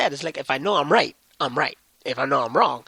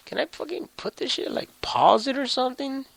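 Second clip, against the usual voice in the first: much higher, fed-up outburst; exasperated; 11 semitones higher than usual. Real, not acted. Vlogger annoyed that he can't pause the recording, voice jumping high. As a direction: squeak out the frustration.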